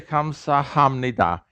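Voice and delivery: slow "Kamsahamnida" with the H fully sounded; bookish full pronunciation. Shown as a demonstration. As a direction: keep the H in "Kamsahamnida", every syllable separate. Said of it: "they don't say".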